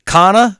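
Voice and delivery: slow, drawn-out "Kana" with no R; East Coast pronunciation. Retold or imitated. Host introducing guest in his accent, R dropped. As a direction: stretch "Kana", ending on "ah".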